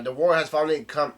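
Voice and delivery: retold cheering. Recapping the episode, a soldier cheering the war's end, mildly mock. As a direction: call it out like a crowd celebrating. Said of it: "starts cheering out".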